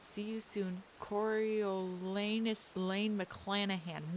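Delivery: ordinary delivery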